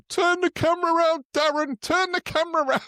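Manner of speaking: squeaky mock order. Mocking Bridge ordering his mate to turn the camera, in a squeaky high voice. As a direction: boss Darren about in a silly squeak.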